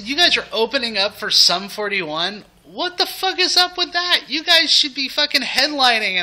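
very high, loud outraged rant; drunken indignant rant. Retold or imitated. Fan ranting at [name] about opening for Sum 41, very high loud rant. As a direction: rant at him like he's been robbed.

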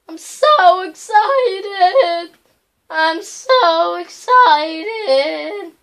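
high, very loud, drawn-out squeal of excitement; wildly hyped, stretching every word; over-the-top excited. Real, not acted. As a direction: belt it out high and slow.

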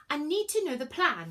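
pushy, high-pitched demand; insistent. A narrator's reading. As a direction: insist on being told. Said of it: "she insisted".

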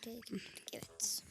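hushed whisper. Real, not acted. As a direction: whisper it under your breath. Whispering aside.

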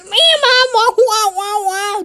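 put-on very high squeaky baby talk; mock baby voice. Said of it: "Talking like a little bitch".

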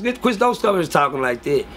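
usual speaking voice

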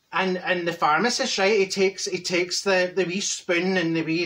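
ordinary delivery

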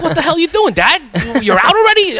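high, bright mock-child voice asking dad what happened; kids teasing.

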